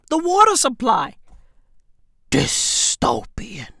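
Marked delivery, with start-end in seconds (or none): 2.3-3.7 low slow whispered word; ominous whisper; slow spooky whisper. Acted. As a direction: draw it out in an eerie whisper. Dramatic whisper.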